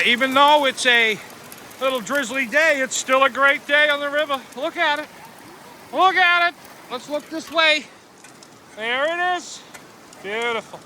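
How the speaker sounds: bright, high-pitched delight; excited. Real, not acted. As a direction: gush about the view. Admiring the river on camera.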